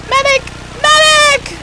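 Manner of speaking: high, loud call for a medic; man down shout. Real, not acted. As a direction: yell for help across the field. Calling for a medic.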